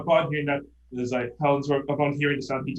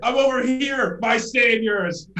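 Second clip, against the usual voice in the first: calling to rescuers; 10 semitones higher, 7 dB louder than usual. Acted.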